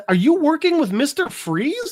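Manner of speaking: high-pitched acted astonishment; imitating a stunned accusation; incredulous. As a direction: ask it in disbelief, voice shooting up. Dick voiced, shocked by his discovery.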